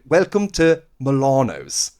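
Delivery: slow announcer line, Milano's with long AH; hammed-up "Welcome to mih-LAH-nohs"; mock English pronunciation. Irish actor mocking the requested ad read, "Milano's" with long AH. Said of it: "If I was going around saying".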